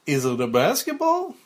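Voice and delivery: put-on loud kids' show host guessing game; cartoonish mailman. Mike studies the gift, loud cartoonish mailman voice. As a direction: ask big, with exaggerated wondering. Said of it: "at a cartoonish Mike the Mailman".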